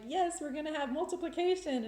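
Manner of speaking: high, elated echo of a cheering reply; excited quote. Retold or imitated. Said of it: "that was exciting".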